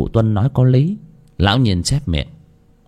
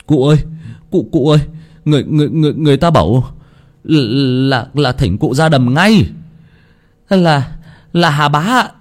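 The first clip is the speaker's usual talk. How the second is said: high, loud, stumbling terrified stutter; stammering in panic; 5 semitones higher, 3 dB louder than usual. Acted. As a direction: blurt it out, tripping over every word in fright. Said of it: "chạy vào lắp bắp". (gia nhân hoảng hốt báo tin cho cụ, giọng cao, to, lắp bắp)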